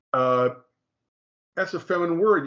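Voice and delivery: ordinary delivery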